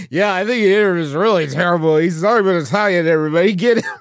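lightly put-on voice actor bit; loud mock announcement to the crowd; Charles Martinet. Voice actor addressing everybody about his replacement, mock loud denunciation. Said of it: "Yeah, Martinet's like".

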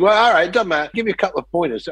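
put-on high Cockney director's accent; Tony Scott. British director conceding to the actor, high Cockney accent. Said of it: "A Cockney guy speaking with some lower class British accent".

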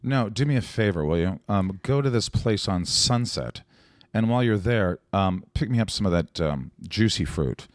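ordinary delivery

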